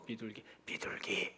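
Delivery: very soft, half-whispered repeat; hushed voice. Acted. Grandmother repeating her password hint to the baffled clerk, hushed. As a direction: murmur it secretively. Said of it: "작은 목소리로".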